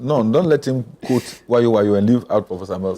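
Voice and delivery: loud, insistent interjection; loud objection. Real, not acted. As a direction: cut in loudly to set the record straight. Cuts in to object to quote.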